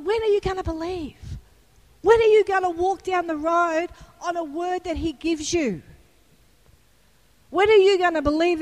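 high, loud preacher's cry building through the questions; preaching at full force. Acted. Preacher urging the congregation to believe, loud and high. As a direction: fire the church up, pressing each "when are you gonna".

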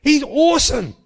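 high-pitched admiring enthusiasm; retold glowing praise. Paul praising his colleague to the president, high enthusiastic praise. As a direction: talk him up, voice pitched well up. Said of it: "sing this man's praises".

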